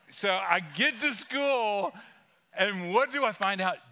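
slow indignant build to the betrayal; building outrage. Retold or imitated. Dramatic story reveal.

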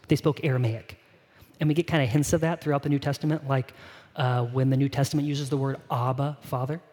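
usual speaking voice

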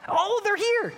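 put-on high, loud burst of kid excitement; excited kids. Kids at the door thinking friends arrived, excited high cry. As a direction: squeal it like a child at the door.

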